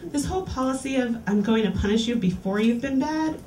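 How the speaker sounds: usual speaking voice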